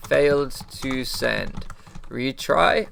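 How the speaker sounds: very slow, word-by-word reading of an error; deliberate readout. Retold or imitated. Repeats error message word by word.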